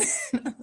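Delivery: high short laugh; real amused laugh. Real, not acted. Laugh at the situation.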